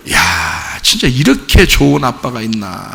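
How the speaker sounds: low, soft, wondering aside; admiring thought. Acted. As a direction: voice it as a private marvel. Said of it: "그런 생각이 들 정도예요".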